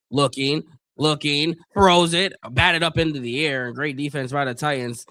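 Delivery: steady commentator call, building as the run breaks; live play-by-play. Acted. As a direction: call the snap to the tackle like a broadcaster. Calls the game live.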